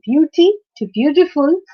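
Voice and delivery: beauty to beautiful, each leaning on the opening B; stress on first syllable. Shown as a demonstration. Teacher showing suffix keeps stress, BEAU stressed in beauty and beautiful. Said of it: "again the stress is on B again".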